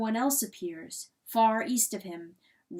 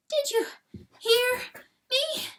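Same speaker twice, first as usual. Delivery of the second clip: high, loud, pleading cry; 3 dB louder than usual. A narrator's reading. Hen crying to unmoved rooster, high frantic plea. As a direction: demand to be heard, high and frantic. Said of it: "Pertolote cries".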